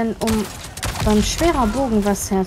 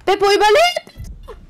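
much higher, slow cheer; excited cheer; 3 dB louder than usual. Real, not acted. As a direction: cheer him on. Cheering teammate surviving.

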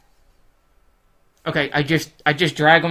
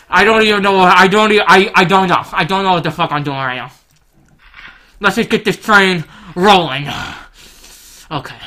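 ordinary talk

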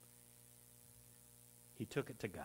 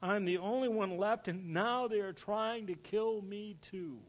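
whiny, higher pitched complaint; self-pitying whine; 6 semitones higher than usual. Acted. Preacher mocks Elijah's self-pity, in a whiny voice. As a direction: play it up, poor me. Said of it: "You hear it, right?".

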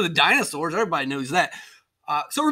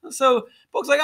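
ordinary delivery

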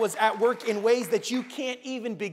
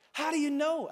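higher, lightly acted puzzled question; a touch of disbelief, pitched up; questioning boy; 8 semitones higher than usual. A narrator's reading. Boy questioning the mysterious voice, puzzled.

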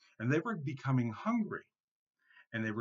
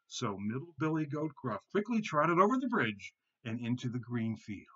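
ordinary delivery

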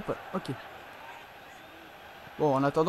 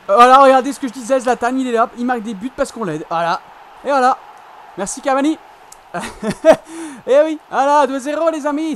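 triumphant gloating; 3 semitones higher, 9 dB louder than usual. Real, not acted. Gloating after a goal.

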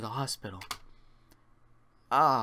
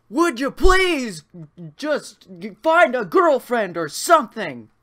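louder, higher, slow annoyed yell through the wall; exasperated shout; 10 semitones higher, 12 dB louder, slower than usual. Acted. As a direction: yell at the noisy neighbour to knock it off. Yelling at noisy neighbour.

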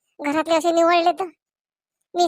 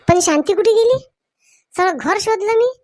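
higher, louder worried calling; anxious old man who cannot find his wife; worried husband; 5 semitones higher, 3 dB louder than usual. Acted.

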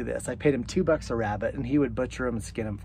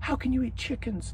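high, soft pretend protest; mock objector; 10 semitones higher, 5 dB quieter than usual. Chicken lover to chicken eaters, high mock dismay. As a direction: play the upset chicken lover, pitched right up.

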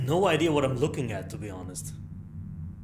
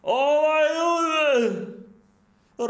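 startled cry; 11 semitones higher, 4 dB louder than usual. Real, not acted. Player reacting to the game's opening, startled cry. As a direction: cry out in real surprise.

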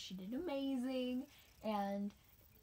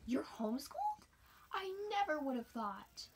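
mock astonished runner; surprised; 7 semitones higher than usual. Girl surprised he's homeschooled.

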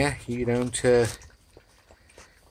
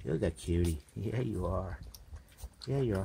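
very soft, low murmur; cooing to a puppy; 6 semitones lower, 15 dB quieter than usual. Real, not acted. Owner fussing over a cute puppy, soft cooing. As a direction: coo it quietly to a little pup.

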